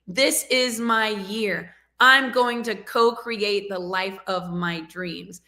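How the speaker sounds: slow, deliberate, every word weighted; slow motivational affirmation. Shown as a demonstration. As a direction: let each word land like a vow. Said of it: "I want you to say that with me".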